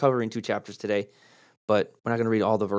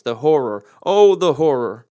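louder, slow, theatrical cry of horror; big over-the-top stage despair; hammed-up dramatic wail; 2 semitones higher, 9 dB louder, slower than usual. Shown as a demonstration. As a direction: belt it out like a tragic actor. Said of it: "you've repeated the horror twice".

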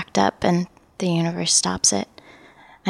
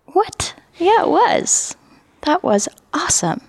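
indignant delight; 11 semitones higher than usual. Retold or imitated. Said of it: "I thought".

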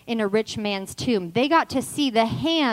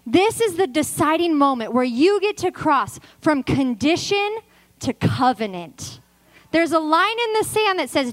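raised, driving pulpit challenge; full-force preaching; 3 semitones higher, 4 dB louder than usual. Acted. Preacher challenging the ladies to cross over, forceful and urgent. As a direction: press the challenge on the congregation.

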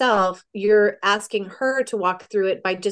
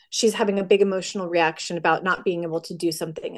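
ordinary talk

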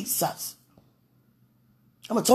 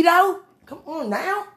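much higher, teasing; high playful plea; 11 semitones higher, 5 dB louder, slower than usual. Real, not acted. Asking God playfully, much higher plea. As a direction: lift it way up playfully.

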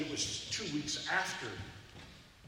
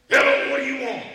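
a loud, higher, mock-tough bark at the door; gruff loud challenge; 10 semitones higher, 15 dB louder than usual. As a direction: play the giant, scaring off strangers. Huge man answers the door.

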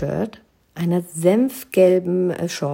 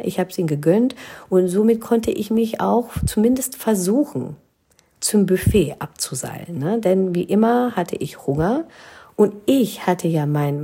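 ordinary delivery

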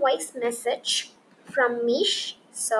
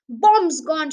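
triumphant cry; 3 semitones higher, 7 dB louder than usual. Acted. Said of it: "she cried".

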